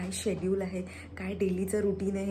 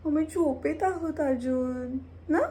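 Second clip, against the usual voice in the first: put-on high, louder baby voice for the pet with a rising question; cutesy pet voice, higher and louder; 7 semitones higher, 5 dB louder than usual. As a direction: coo it for the pet. Voicing the pet again.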